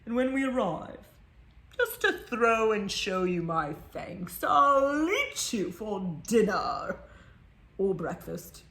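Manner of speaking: slow, menacing tiger purr. Acted. Tiger teasing the child about dinner, slow and menacing. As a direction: savor the threat like a hungry tiger. Tiger voices a threat in story.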